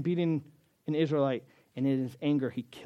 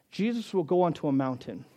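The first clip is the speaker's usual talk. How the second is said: ordinary delivery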